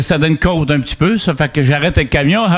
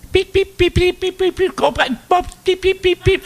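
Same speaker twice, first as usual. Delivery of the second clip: high rapid-fire bleeps for an angry woman's curses; mock bleeped swearing. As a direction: squeak out censor beeps in a furious rant. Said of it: "il y en avait des pip".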